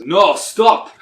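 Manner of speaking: high, loud shout; shouted stop. Acted. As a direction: yell it to break up the fight. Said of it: "in a heavy accent, he goes".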